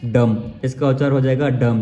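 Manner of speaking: डम with nothing after the m; silent b. Shown as a demonstration. Teacher answering own question on reading, silent b. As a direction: say डम, leaving out any b. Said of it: "इसको पढ़ेंगे क्या".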